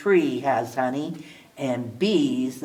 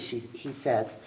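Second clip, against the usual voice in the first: usual speaking voice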